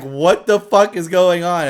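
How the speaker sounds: loud, slow, incredulous exclamation; bewildered outburst. Acted. Voicing bewildered player character.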